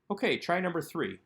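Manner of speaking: ordinary talk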